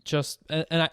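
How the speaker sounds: normal talking voice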